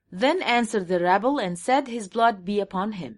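very slow, word-by-word English Bible reading; slow scripture reading. Shown as a demonstration. Reading the English verse aloud to his audience, very slow and deliberate. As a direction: lay out each word of the verse slowly.

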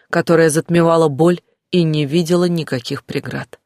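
usual speaking voice

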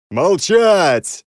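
hoarse, high furious shout; hoarse yell. A narrator's reading. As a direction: bark it hoarsely at the crowd. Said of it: "хрипло заорал я".

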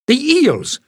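narrator's excited Jasmine; exclaimed. A narrator's reading. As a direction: blurt out the idea. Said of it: "Jasmine exclaimed".

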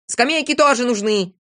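heated insistence; quarrelsome shout. A narrator's reading. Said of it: "кричал Авоська".

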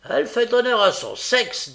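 high, loud acclaim; hearty praise. A narrator's reading. Sikes praises Nancy to the gang, loud and hearty. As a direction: proclaim it to the whole room.

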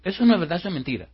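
high, loud burst of acted denial; upset denial. Upset man denies what his friend revealed, protesting. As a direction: protest it, starting high and falling. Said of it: "el feo se incomodó".

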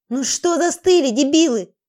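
angry loud yell at henchmen. Acted. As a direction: roar it at the frozen goons. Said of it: "заорал он".

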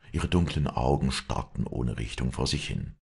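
usual speaking voice

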